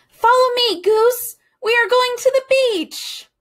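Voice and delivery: high loud shout of pure excitement; excited call. A narrator's reading. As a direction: call back while running downhill. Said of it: "Duck running to beach".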